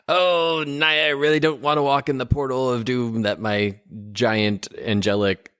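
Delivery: high, whiny reluctant voice; reluctant whiny hero. Acted. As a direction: whine about the portal of doom. Character reluctantly follows into portal.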